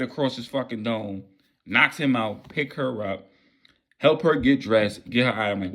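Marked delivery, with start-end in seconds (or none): none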